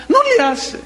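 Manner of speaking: a preacher imitating his weeping mother; mock old mother. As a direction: play the tender tearful old mother. Voicing his tearful mother.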